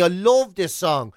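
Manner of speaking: normal talking voice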